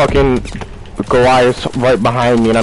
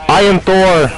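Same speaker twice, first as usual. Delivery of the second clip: excited boast; 8 semitones higher, 3 dB louder, slower than usual. Real, not acted. Gamer boasting after big kill.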